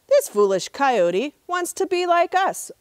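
high mocking crow voice; trickster tale voices. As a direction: sneer it like a scheming crow. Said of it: "Old Man Crow said to his flock".

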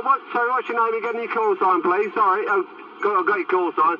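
ordinary talk, nothing marked

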